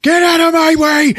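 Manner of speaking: very high, loud furious yell; shout. Retold or imitated. Stone pushing Durkin back out the door, very high shout. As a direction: roar it, shoving past. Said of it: "Stone just goes".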